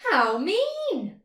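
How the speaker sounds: high indignant cry of the mole; cried out. A narrator's reading. Mole crying out at the mess on his head. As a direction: yelp it out, offended. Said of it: "cried the little mole".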